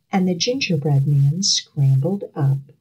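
usual speaking voice

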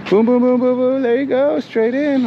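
high, bouncy playful coaching. Real, not acted. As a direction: cheer them in playfully. Guiding parking playfully.

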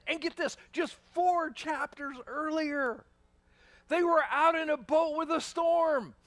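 impassioned preaching. Real, not acted. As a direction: press the point home to the congregation. Preaching at full force.

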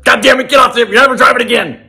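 high, loud outburst imitating Mom; angry mom. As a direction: imitate a mom yelling at her kid at the wheel. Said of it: "and then Mom said".

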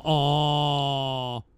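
ordinary talk, nothing marked

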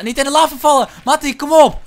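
high, loud warning to teammate; urgent shout. Real, not acted. Player steering teammate past lava, urgent shout. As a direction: yell to hurry and keep him safe.

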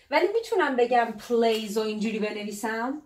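usual speaking voice

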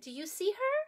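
ordinary delivery

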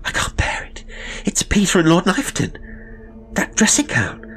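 high, breaking, half-whispered horror; sickened, fighting panic; horrified. A narrator's reading. Sister horrified by what she saw, pleading with her brother.

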